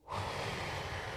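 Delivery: long breathy blow out; unvoiced calming exhale; slow demo exhale. Shown as a demonstration. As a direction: blow out gently as if cooling ice cream. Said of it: "Do that several times".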